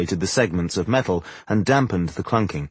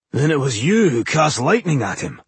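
higher, excited dwarf voice; 5 semitones higher than usual. A narrator's reading. Dwarf realises who attacked their enemy, excited. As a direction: blurt it out with keen excitement. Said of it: "Boindil said excitedly".

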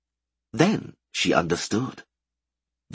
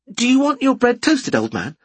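higher, louder teasing call; smiling voice; 5 dB louder than usual. A narrator's reading. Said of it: "with a smile in her voice".

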